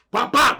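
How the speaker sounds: loud high popping punch noises; punch sounds. Retold or imitated. Mimicking Chris landing blows, loud mouth pops. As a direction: smack out each hit.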